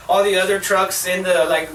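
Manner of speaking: ordinary talk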